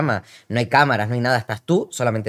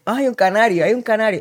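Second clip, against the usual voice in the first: higher, gushing mock of the locals; excited locals; 10 semitones higher, 4 dB louder than usual. People greeting a visitor from the Canaries, higher gushing mock voice. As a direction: squeal it like a fan spotting a canario. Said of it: "como que la gente".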